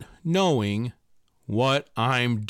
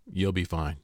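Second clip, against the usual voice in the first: low, soft, emotionless reassurance; dry deadpan; 9 semitones lower, 6 dB quieter than usual. Retold or imitated. Actress shrugging off his panic, low soft deadpan. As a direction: say it flat and unmoved, much lower and softer. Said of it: "looks at me in the most dry pan, non-emotional face and says".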